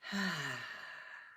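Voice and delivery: long falling open sigh; audible sigh. Real, not acted. Teacher pausing over the coming tests, long sigh. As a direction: let out a long breathy "hah". Pauses amid heavy warning.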